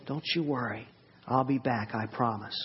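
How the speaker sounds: low, soft movie-dad promise; tender reassurance. Acted. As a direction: comfort a frightened child, quietly. Movie dad reassuring crying kids.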